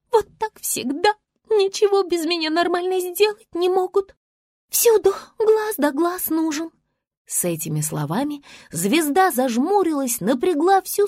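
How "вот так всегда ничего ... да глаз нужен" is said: high-pitched cross grumbling; grumpy star. A narrator's reading. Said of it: "Звезда сердито буркнула". (сердитое ворчание высоким голосом)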